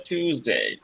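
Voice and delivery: টিউজডে as a plain too sound, falling; American accent. Shown as a demonstration. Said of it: "আমেরিকানরা".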